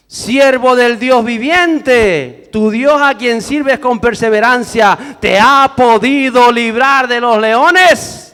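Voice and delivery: slow, high cry with full force; king's anguished call to Daniel; anguished shout. Acted. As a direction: shout each word out slowly and desperately. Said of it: "gritó a Daniel con voz angustiada".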